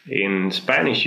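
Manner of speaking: ordinary delivery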